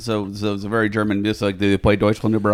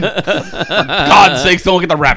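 raised, loud hammed-up plea; mock urgent warning; 5 semitones higher, 6 dB louder, slower than usual. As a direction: shout a frantic parody movie warning. Joins the acted movie warning.

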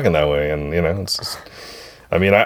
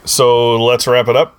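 ordinary talk, nothing marked

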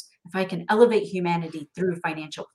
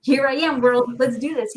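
louder, higher, fired-up announcement; bold declaration; 5 semitones higher, 5 dB louder than usual. Retold or imitated. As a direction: call it out to the world, ready to go. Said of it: "I'm like".

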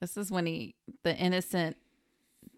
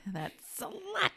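echoing the outburst; 8 semitones higher than usual. Retold or imitated. Cohost echoes the line.

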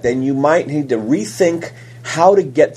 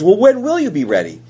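daughter asking; 7 semitones higher than usual. Retold or imitated. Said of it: "She goes".

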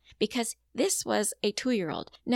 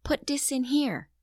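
put-on child voice; 7 semitones higher, 3 dB louder than usual. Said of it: "He says".

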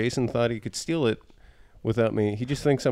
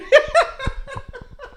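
high laugh. Real, not acted. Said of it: "So bad".

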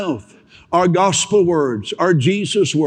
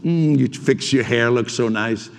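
idle small talk; 8 semitones lower than usual. Retold or imitated. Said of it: "if I just said".

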